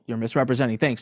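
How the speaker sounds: ordinary delivery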